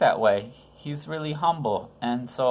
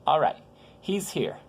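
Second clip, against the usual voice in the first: ordinary delivery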